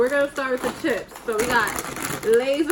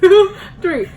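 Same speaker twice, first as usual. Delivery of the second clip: laughing; 6 semitones higher, 11 dB louder, much slower than usual. Real, not acted. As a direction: count through giggles. Laughing through the countdown.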